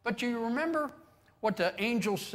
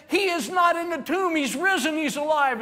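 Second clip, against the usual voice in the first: high, loud breathless news; excited announcing; 12 semitones higher, 9 dB louder than usual. Acted. Women bursting in to tell the men, excited and loud. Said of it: "They go in and they said".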